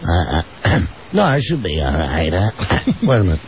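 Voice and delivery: low, gruff Dice voice. Acted. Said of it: "your voice sounds different".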